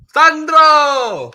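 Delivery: high loud shout of a name; calling out. Real, not acted. Streamer hailing a viewer in chat with a shout. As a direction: call the name out big and high.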